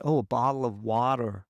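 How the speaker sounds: "bottle of water" with an American flapped t and an r at the end; Americanised pronunciation. Shown as a demonstration. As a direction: switch to an American accent to be understood. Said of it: "And I'll be like".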